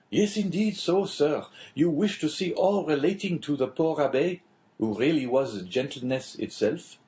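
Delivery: a hint of the chatty official; lightly voiced prison inspector. Inspector telling the Englishman a prison tale, lightly voiced.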